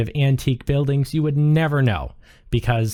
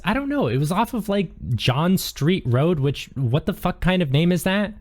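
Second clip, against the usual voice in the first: high-pitched, baffled helper voice; higher voice, incredulous at the end; Lola; 6 semitones higher than usual. Acted. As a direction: voice a puzzled character, pitched well up. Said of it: "Lola says".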